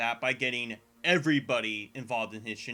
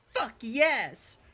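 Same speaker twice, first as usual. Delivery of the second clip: higher-pitched imitated cheer; excited proclamation; 9 semitones higher than usual. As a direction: imitate an excited, drawn-out exclamation. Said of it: "Charlie excitedly proclaims".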